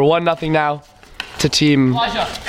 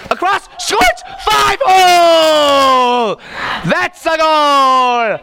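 high, drawn-out shouted goal call; 9 semitones higher, 5 dB louder, much slower than usual. Acted. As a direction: scream it like a soccer announcer.

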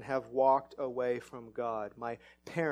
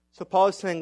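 usual speaking voice

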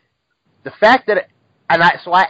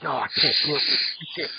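breathless muttered despair; whispered exasperation; 5 dB quieter, faster than usual. Retold or imitated. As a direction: whisper it, fed up and done. Retold exasperation.

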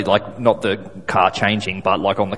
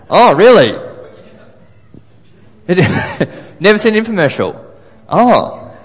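surprised; 7 semitones higher, 5 dB louder than usual. Real, not acted. Audience never saw infomercials.

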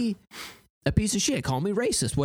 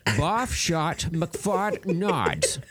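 goofy voice; 5 semitones higher, much slower than usual. Pretend. Nonsense voice gag.

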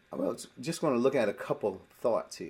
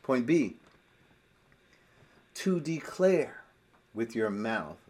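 ordinary talk

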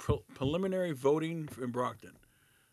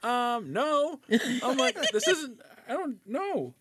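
high and loud, fed-up protest; mock outrage at the absent boss; exasperated refusal; 11 semitones higher, 6 dB louder than usual.

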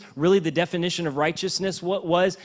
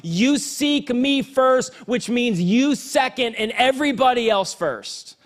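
preaching at full force; 7 semitones higher than usual. Acted. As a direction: preach it out to the whole church, building.

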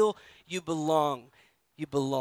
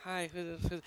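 puzzled mumble; 13 dB quieter than usual. Pretend. Table guests puzzled by newcomer.

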